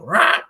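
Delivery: loud, high imitated screech; mock pterodactyl cry. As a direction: screech like a pterodactyl from a movie quote. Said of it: "Is that a pterodactyl?".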